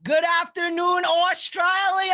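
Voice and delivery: loud, slow, drawn-out welcome; shouted greeting. Acted. Host hailing Australia with a loud drawn-out shout. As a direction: bellow it out to the whole country.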